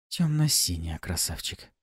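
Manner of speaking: whispered seductive correction; breathy purring seductress. A narrator's reading. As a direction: breathe it like a temptress. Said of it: "В тон мне отозвалась Пафи".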